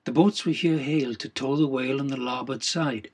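ordinary delivery, nothing marked

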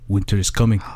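Jon Snow impression. Shown as a demonstration. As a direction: say it darkly like a northern lord. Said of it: "let me close my eyes".

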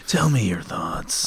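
slow breathy whisper; leaning in to whisper. Pretend. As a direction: hush it like a secret. Joking whisper to co-host.

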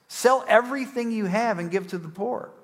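harsh-sounding command. Retold or imitated. Preacher quoting Jesus to the rich man, seemingly harsh command. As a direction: deliver it as a demanding order. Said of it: "it seems like Jesus is being harsh".

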